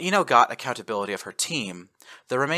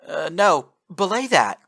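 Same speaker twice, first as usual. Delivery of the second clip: voice cracking; 7 semitones higher, 6 dB louder than usual. Acted. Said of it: "Her voice cracked".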